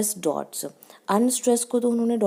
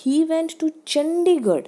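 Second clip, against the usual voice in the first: "He went to Chandigarh" pitched much higher and louder, as a question; question tune; 8 semitones higher, 5 dB louder, slower than usual. Shown as a demonstration. Teacher demonstrates the question form to students, voice raised high. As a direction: ask it in disbelief, voice lifted high. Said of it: "question में".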